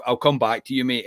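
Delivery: ordinary talk, nothing marked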